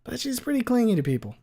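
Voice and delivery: put-on bubbly, high-pitched girl; chirpy teen girl; high girly voice.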